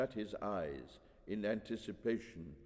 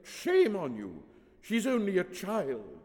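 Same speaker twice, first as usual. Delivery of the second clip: loud, higher-pitched scolding; indignant exclamation; 10 semitones higher, 6 dB louder than usual. A narrator's reading. Woman scolding over a child, exclaiming. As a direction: exclaim it in protest. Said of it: "the woman exclaimed".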